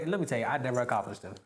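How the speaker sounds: ordinary talk, nothing marked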